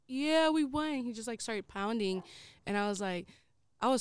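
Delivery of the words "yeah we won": drawn-up, high celebratory quote; pumped winner's cheer, pitched up; retold victory cheer. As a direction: imitate a teammate cheering the win. Teammate cracks bottle after winning.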